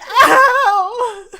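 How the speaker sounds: sudden high, loud yelp of pain. Acted. Salvador hit in the cheek, high loud yelp. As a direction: yelp as the dagger hits. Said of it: "He's like".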